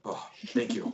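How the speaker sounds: small high voice of a rescued gnome; grateful gnome. Acted. Gnome freed from the rat thanks rescuers, high and soft.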